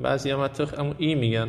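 normal talking voice

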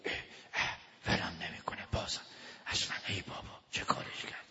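exasperated whisper with sighs; inner grumbling. Acted. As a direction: grumble to yourself under your breath. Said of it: "تو خوش داره میگه".

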